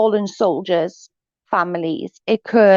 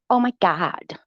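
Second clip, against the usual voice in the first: quieter, lightly mocking sigh of drama; soft mock; 3 dB quieter than usual. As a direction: toss it off under your breath. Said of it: "She is so dramatic".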